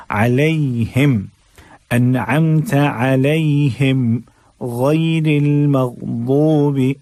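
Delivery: slow, drawn-out chanted verse; Quran recitation. Shown as a demonstration. Teacher recites the verse slowly as a model. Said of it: "अब ये जो दाद है".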